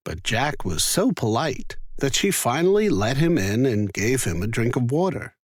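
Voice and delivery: usual speaking voice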